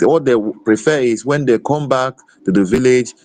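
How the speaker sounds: normal talking voice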